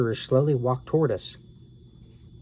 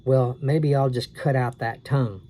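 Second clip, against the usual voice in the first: ordinary delivery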